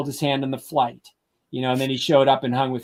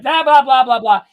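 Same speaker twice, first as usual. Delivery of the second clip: higher, louder blah blah of a nagging passenger; mock ranting; 10 semitones higher, 7 dB louder than usual. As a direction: spoof the endless yelling. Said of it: "yelling at me".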